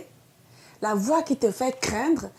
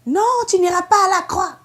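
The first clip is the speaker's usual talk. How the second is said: higher, louder insistent refusal; Satan speaking through Peter; 8 semitones higher, 7 dB louder than usual. Retold or imitated. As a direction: forbid him firmly, loudly. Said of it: "la voix de Satan". (voix forte et impérieuse de Satan)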